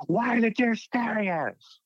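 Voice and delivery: very high, strained panicked cry; hammed-up high-pitched alarm; panicked friend. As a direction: imitate a freaked-out friend crying out. Freaked-out friend on watching deer.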